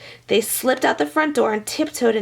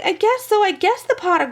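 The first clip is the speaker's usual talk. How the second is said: high, unsure wail; high fretful girl voice; 9 semitones higher than usual. A narrator's reading. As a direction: whimper it out hesitantly and high. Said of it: "wailed Meg".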